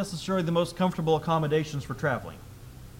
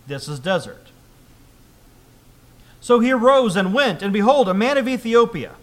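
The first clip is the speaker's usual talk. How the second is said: loud, emphatic public Bible reading; scripture reading; 6 dB louder than usual. Acted. Preacher reads Acts to the congregation, loud scripture reading. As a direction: read the passage out firmly to the congregation.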